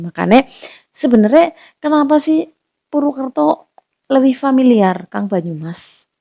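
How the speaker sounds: usual speaking voice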